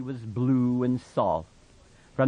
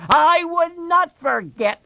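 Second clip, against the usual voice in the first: much higher and louder protest; 8 dB louder than usual. Acted. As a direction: shout the denial. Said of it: "Lenny said loudly".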